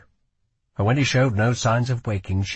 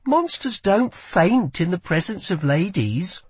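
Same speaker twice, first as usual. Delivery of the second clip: mocking; 8 semitones higher than usual. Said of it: "small mocking laugh".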